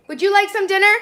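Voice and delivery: high, loud call to another room. Real, not acted. Calls to child in another room.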